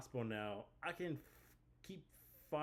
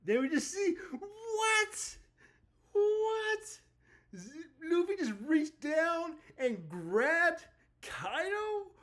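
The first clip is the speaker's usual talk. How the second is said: real shrieking excitement, much higher and louder; hyped screaming; 7 dB louder, slower than usual. Real, not acted. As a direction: lose it completely at the big reveal. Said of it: "'cause that was hyped for this chapter".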